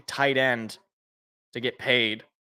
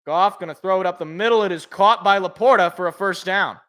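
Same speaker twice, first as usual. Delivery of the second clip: broadcaster calling each snap, opening louder and higher; play-by-play; 5 semitones higher, 6 dB louder than usual. Acted. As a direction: narrate the play live like a TV announcer. Live call of a pass.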